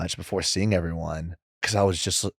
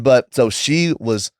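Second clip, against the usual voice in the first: usual speaking voice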